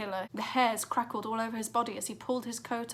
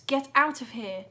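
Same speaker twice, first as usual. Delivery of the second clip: urgent warning; 3 semitones higher, 7 dB louder than usual. A narrator's reading. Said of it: "Wild Boy said".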